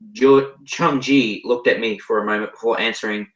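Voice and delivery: plain reading voice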